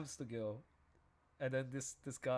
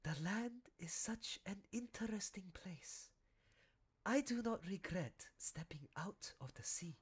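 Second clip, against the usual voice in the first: whispered, slow, dreamy line; a seal musing softly to the wolf; hushed seal voice; 6 semitones higher, 4 dB quieter than usual. Pretend. Acting seal dialogue scene.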